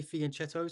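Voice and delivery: "fianchetto" with ch as in chess; "fianchetto" said with a ch sound. Shown as a demonstration. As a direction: say "fianchetto" as fee-an-CHET-o. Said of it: "I normally say".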